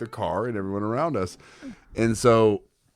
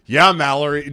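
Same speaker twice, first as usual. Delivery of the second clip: louder, high mocking jab; taunting; 7 semitones higher, 8 dB louder than usual. Brother goads driving sister louder. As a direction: needle her on purpose.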